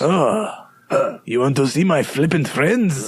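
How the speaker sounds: light Eastern European voice; a hint of an Eastern European accent. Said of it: "all my accents go to the Eastern European voice".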